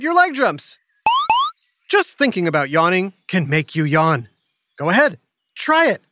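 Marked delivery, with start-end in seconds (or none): none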